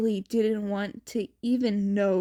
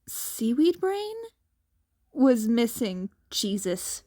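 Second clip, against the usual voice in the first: plain reading voice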